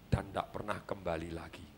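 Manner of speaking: a father letting the sad ending sink in before a long silence; hushed dramatic ending. Shown as a demonstration. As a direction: drop the voice to almost nothing for the tragic end. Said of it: "Ini cara mendeliver".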